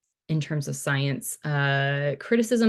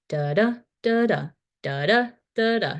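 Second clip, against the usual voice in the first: da, da with every second da pitched higher and louder; iambic rhythm; 5 semitones higher, 3 dB louder than usual. Shown as a demonstration. Lecturer demonstrating iambic meter to students, stressed second da. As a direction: tap out the iambic beat, stressing each second da. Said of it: "well, I can show you".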